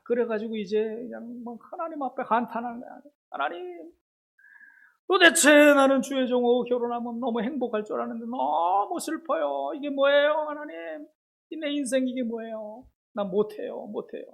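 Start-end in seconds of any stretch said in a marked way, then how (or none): 3.3-14.2 high, wailing prayer, mildly tearful; retold wife pleading with God, close to tears; tearful lament. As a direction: pour out grief to God, half-crying. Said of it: "막 엉엉 울면서 기도하는".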